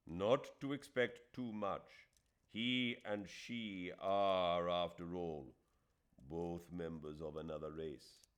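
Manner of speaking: slow, weighty, lightly voiced; a hint of a grave older friend cautioning Aziz; Hamidullah's warning. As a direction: warn him gravely and slowly.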